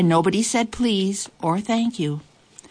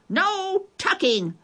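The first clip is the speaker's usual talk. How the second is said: much higher, slow chant; crew yelling; slower than usual. A narrator's reading. The crew yells at the boy who wants tucking in. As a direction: drag it out as the whole crew. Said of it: "the crew yelled".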